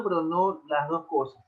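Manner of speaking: normal talking voice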